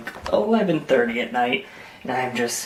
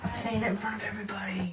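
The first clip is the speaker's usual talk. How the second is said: hushed whisper, quick; 8 semitones higher, 11 dB quieter, faster than usual. Real, not acted. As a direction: whisper it fast. Aside so others won't hear.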